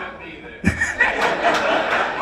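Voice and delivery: high unvoiced cackling laugh; high cackle. Real, not acted. Comic laughing at the crowd member, high wheeze.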